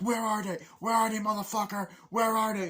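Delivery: high, loud mock demand; re-enacted aggressive questioning; acted interrogation. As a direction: hammer the question like a raging soldier. Acts out interrogation.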